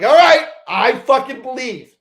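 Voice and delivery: high, loud, slow "I believe" yell; fired-up shout. Retold or imitated. Driver to crew chief on the radio, loud fired-up surrender. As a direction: bellow it like a battle cry.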